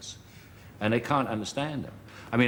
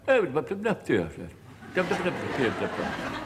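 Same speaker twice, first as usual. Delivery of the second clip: mock posh babble; 5 semitones higher than usual. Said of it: "everybody seemed to be going".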